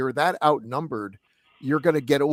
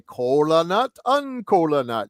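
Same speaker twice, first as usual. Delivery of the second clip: put-on slow, slightly raised Jamaican accent; mock Jamaican accent; 3 semitones higher, 3 dB louder, slower than usual. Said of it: "if you're doing a Jamaican accent".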